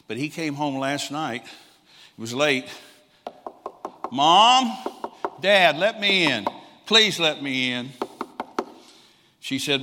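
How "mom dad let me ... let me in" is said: high, loud, slow desperate pleading; a son calling through a locked door to his parents, high and loud begging; retold plea at the door.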